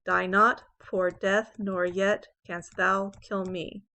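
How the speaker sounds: "Die not, poor death, nor yet canst thou kill me" scanned weak-strong; iambic pentameter. Shown as a demonstration. Teacher scanning an example, iambic beat on "Die not, poor death, nor yet canst thou kill me". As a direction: stress every second word, "not", "death", "yet", "thou", "me". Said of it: "let's look at this as an example".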